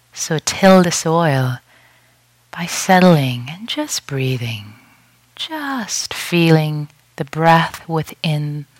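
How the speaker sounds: unhurried meditation guide with long pauses; guided meditation. Real, not acted. Teacher guiding retreatants to settle and breathe, guided meditation voice. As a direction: lead them gently into stillness, leaving space between phrases.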